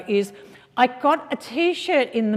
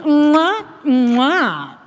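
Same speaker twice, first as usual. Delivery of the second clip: air kisses; 6 semitones higher, 6 dB louder than usual. Acted. Air kisses greeting guest.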